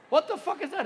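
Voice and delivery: normal talking voice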